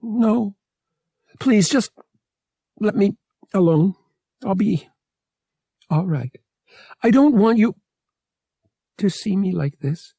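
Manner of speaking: high-pitched tearful woman, halting; upset and sniffly, in broken bursts; sniffling. A narrator's reading. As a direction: plead through tears, breaking up the phrases. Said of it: "she sniffled".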